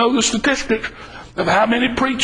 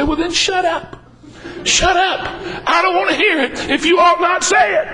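higher, blunt "shut up" comeback; a tattler hears a mock retort, higher and blunt; 6 semitones higher than usual. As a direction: snap it back at the gossip, half joking. Preacher voices blunt retort.

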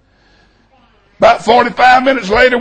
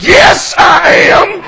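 exasperated retort; 3 semitones higher, 4 dB louder, slower than usual. Retold or imitated. Snapped answer to wife, mid-argument.

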